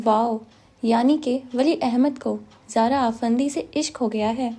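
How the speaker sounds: plain reading voice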